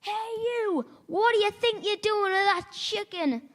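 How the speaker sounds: high mock shout of a guard; security guard shouting. Security guard chasing a chicken thief, high mock shout. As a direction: yell after the thief like a guard. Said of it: "So he shouted".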